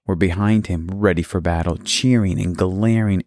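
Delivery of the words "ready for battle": ordinary delivery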